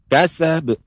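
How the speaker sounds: "Kasab" with the final b released in a bounce; "Kasab" ending in a popped, echoing b; bounced final b. Shown as a demonstration. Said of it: "pengucapannya pantulan".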